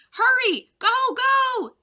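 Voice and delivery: very high, loud urging; frantic shouted urging. Acted. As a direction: urge her to flee the merchants. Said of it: "Jack shouted at Morning Breeze".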